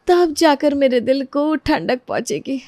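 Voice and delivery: usual speaking voice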